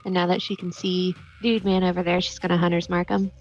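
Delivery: usual speaking voice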